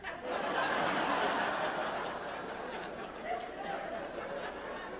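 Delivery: high airy laughter without voice; breathy laugh. Real, not acted. Speaker amused retelling the friend's retort, airy laugh.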